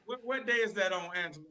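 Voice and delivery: muffled and far from the mic. Real, not acted. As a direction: sounds far back and muffled. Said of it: "You, you got muffled".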